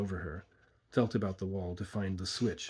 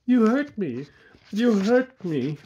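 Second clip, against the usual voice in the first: high, loud cry of pain; pained cries; 12 semitones higher, 9 dB louder, slower than usual. A narrator's reading. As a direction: cry out while struggling, higher and louder. Struggling woman cries out in pain.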